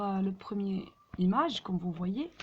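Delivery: normal talking voice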